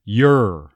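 "your" said as a stressed "yer"; stressed Texas "yer" for "your"; strong weak form. Shown as a demonstration. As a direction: lean on "your" but keep it "yer". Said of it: "strong form of that weak form becoming your".

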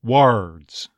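"Words" with strong r, vowel toward uh; hard R "Words". Shown as a demonstration. Coach models the Texas word, hard R "Words". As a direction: open "Words" slightly before a hard r. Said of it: "that hard R definitely".

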